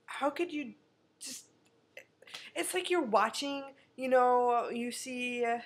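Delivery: ordinary talk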